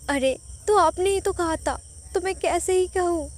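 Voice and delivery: wife snapping back at her teasing husband; indignant retort. A narrator's reading. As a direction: fire back at him, offended. Wife retorts after being teased.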